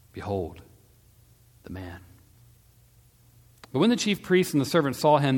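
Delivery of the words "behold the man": low, hushed presentation; subdued proclamation. A narrator's reading. Pilate presenting the beaten Jesus, low and subdued. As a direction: proclaim it gravely, dropping softer at the end. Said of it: "and Pilate proclaimed".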